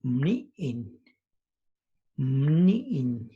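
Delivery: mni'in said with the lips closed tight first; Zapotec word. Shown as a demonstration. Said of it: "which is a child".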